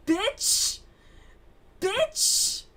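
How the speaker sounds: high yelled Bitch, loud and rising; mock shout. To her friend over tacky flip-flops, a high mock shout. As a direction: yell it at her in disbelief.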